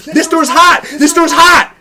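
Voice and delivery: high shouted warning; mock alarm yell; retold frantic yell. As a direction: shout it like a panicking mom. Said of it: "she goes".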